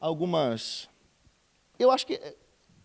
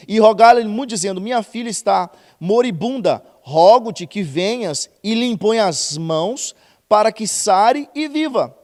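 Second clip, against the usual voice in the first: loud, slow Bible reading to the church; loud preacher reading the passage aloud; preaching scripture reading; 9 dB louder, slower than usual. Acted. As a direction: proclaim the verse like a preacher. Reads scripture at full force.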